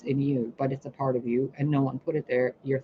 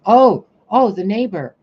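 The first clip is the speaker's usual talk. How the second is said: sudden realization; 4 semitones higher, 11 dB louder than usual. Real, not acted. Suddenly remembers an example.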